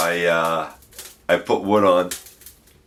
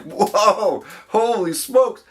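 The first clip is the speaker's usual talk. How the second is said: high, startled "Whoa, holy smokes"; surprised exclaim; 12 semitones higher, much slower than usual. Retold or imitated. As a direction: gasp it out in shock. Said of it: "I was like".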